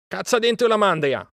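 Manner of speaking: high, urgent order; shouted command. A narrator's reading. Mowgli sending Akela after the herd, shouting. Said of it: "gridò".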